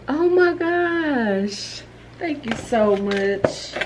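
high, very slow, drawn-out thanks; touched gratitude. Real, not acted. Vlogger thanking the gift sender, slow and moved. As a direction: stretch it out, overwhelmed and grateful.

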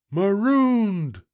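loud, high drawn-out moan; moaning pirates. A narrator's reading. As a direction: moan it like a stranded crew. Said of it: "moaned the others".

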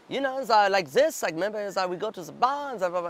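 high-pitched mock German accent. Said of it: "a kind of German accent".

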